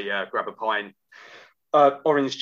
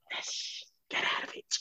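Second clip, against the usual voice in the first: hushed, breathy whisper to himself; whispered private triumph; 12 dB quieter than usual. Retold or imitated. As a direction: whisper it like a secret little victory. Gleeful aside after winning the table.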